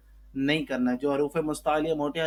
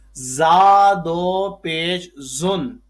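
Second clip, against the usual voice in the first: slow, louder classroom spelling; loud slow drill; 11 dB louder, much slower than usual. Shown as a demonstration. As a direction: call the spelling out slowly and loud.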